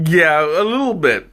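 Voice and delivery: usual speaking voice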